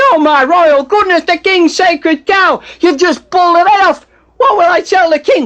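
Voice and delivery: high, loud scream of mock horror; screaming in outrage. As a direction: cry out as if scandalised, at full volume. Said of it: "And Duomo is screaming".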